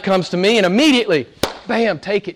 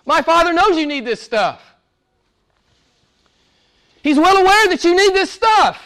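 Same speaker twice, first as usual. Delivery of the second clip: high, insistent preacher voicing Jesus; high-pitched, exasperated insistence; emphatic assurance; 12 semitones higher, 3 dB louder than usual. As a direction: drive it home in a raised pitch. Jesus' words acted out, insistent.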